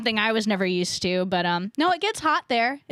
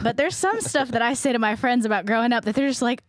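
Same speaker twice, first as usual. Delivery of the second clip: ordinary delivery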